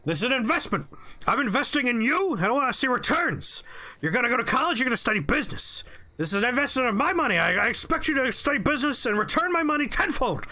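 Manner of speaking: hammed-up manic boss yelling about investments; frothing rant. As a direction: play the coked-up boss losing it over his money. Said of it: "frothing at the mouth and screaming about investments".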